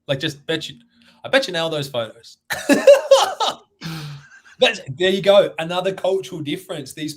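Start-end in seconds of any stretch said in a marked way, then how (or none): 2.5-3.5 high laugh. Real, not acted. Laughing at own joke.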